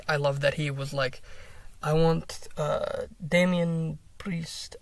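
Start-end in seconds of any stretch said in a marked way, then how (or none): 1.8-4.8 put-on slow, accidental Russian accent. Fan quoting a wrestler's challenge, slipping into Russian accent. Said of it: "I don't know why I went Russian".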